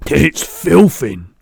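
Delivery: loud, drawn-out giant's splutter; spluttered outburst. A narrator's reading. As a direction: splutter it out loudly in disgust. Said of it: "he spluttered, speaking with his mouth full".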